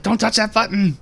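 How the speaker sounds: high-pitched retold yell; urgent mock shout. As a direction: act out a desperate stop order. Retold panicked command from doorway.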